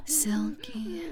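soft intimate whisper; breathy ASMR whisper. Acted. Erotic roleplay whisper.

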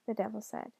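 ordinary delivery, nothing marked

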